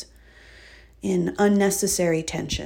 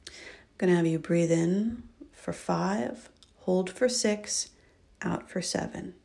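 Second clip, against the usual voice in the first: soft, slow counting of breaths; guided breath count; 3 dB quieter, much slower than usual. Acted. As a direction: count them through each breath calmly.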